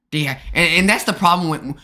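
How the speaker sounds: ordinary delivery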